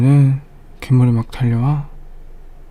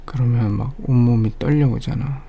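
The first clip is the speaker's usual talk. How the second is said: usual speaking voice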